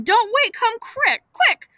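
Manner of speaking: high, rising panic; urgent. Acted. Brother rushing his aunt to the sickbed, panicky. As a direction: hurry her with high urgent cries.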